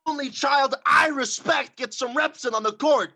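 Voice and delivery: usual speaking voice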